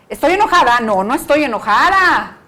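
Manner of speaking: grita negando que está enojada, más fuerte y agudo; negación enojada imitada. Retold or imitated. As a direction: niega el enojo gritando, cada vez más agudo. Madre niega enojo gritando. (mamá gritando que no está enojada, imitada)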